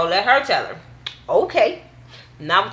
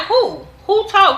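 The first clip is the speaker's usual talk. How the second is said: mock Portia asking; 12 semitones higher, 5 dB louder, slower than usual. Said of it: "She like".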